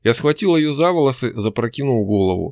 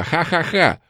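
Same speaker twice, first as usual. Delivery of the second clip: shouted laugh; 6 semitones higher, slower than usual. A narrator's reading. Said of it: "Она закричала".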